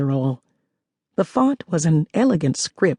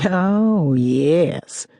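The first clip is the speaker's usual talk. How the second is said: lightly exaggerated Southern drawl, a hint only; mock Southern drawl. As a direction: tease him with a hammed-up Southern drawl. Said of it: "with an exaggerated Southern drawl".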